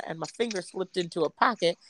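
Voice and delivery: usual speaking voice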